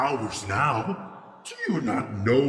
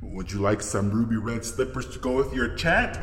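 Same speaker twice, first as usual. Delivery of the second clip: normal talking voice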